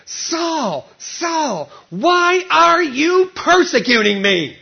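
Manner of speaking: voice from heaven. Acted. Jesus from heaven calling Saul, slow and high. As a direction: call him by name from heaven, slow and grave. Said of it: "heard a voice saying to him".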